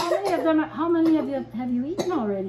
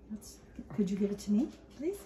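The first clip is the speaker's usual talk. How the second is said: soft whispered request; whispering; 4 semitones lower, 7 dB quieter, faster than usual. Real, not acted. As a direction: ask gently under the breath. Quiet request to the child.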